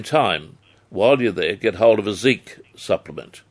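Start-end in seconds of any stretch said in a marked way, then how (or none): none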